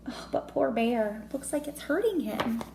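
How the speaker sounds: plain reading voice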